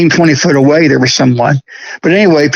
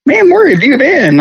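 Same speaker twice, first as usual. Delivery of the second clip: buddy's greeting, pitched much higher; a friend pleased to finally catch him; buddy's excited greeting; 11 semitones higher than usual. Retold or imitated. Buddy asking where he's been, high and eager. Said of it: "he's like".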